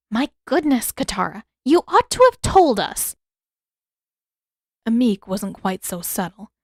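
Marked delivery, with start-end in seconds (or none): 0.1-3.2 shocked. A narrator's reading. Healer gasps at scarred boy.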